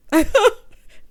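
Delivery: loud, high burst of laughter; big laugh. Real, not acted. Host cracking up at own deceased remark, big laugh.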